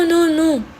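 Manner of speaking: normal talking voice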